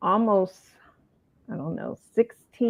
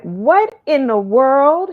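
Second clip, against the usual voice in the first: slow, loud, raised-pitch bewilderment; acted shock, higher and drawn out; retold astonishment; 6 semitones higher, 7 dB louder, slower than usual. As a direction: imitate an alarmed husband, stretching the words out. Retold husband alarmed at her wailing.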